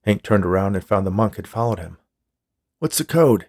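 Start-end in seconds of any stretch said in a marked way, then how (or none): 2.8-3.5 higher-pitched demand. A narrator's reading. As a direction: demand an answer. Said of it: "he demanded".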